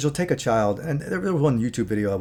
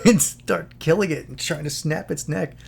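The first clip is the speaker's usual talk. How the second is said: usual speaking voice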